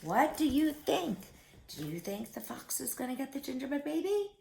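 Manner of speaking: ordinary delivery, nothing marked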